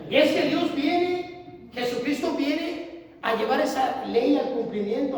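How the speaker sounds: slow, high, loud proclamation; preaching. Acted. Preacher to the congregation, full-force preaching.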